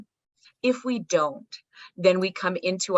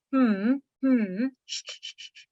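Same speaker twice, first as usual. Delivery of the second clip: soothing hum and shush; 5 semitones higher than usual. Shown as a demonstration. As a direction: hush a crying infant rhythmically. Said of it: "Inherently, we go".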